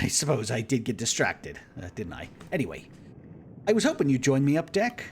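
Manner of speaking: a hint of a jovial explorer; lightly voiced, cheerful celebrity; charming navigator voice. Kurt voice again.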